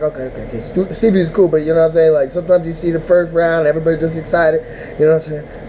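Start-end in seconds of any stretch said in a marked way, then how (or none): 0.0-0.6 quiet resignation. Retold or imitated. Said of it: "So I was like".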